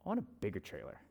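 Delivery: low, hushed mock confession; dropped right down, almost to himself; confessed secret wish. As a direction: admit it very softly, like a guilty secret.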